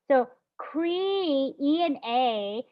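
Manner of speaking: "cre-" held apart from "E and A"; teacher showing separate sounds; sounding out slowly. Shown as a demonstration. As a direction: split the syllables slowly. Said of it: "it's separated".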